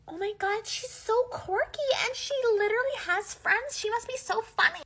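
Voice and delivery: high, bubbly gossip voice; gushing classmates. Retold or imitated. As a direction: coo like an impressed classmate.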